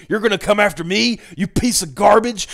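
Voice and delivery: a lightly done conspiracy rant; Alex Jones rant. Retold or imitated. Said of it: "sounding like Alex Jones".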